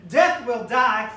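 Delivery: slow, loud proclamation; triumphant pulpit declaration; proclaiming. Acted. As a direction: declare it with full force. Climactic sermon line.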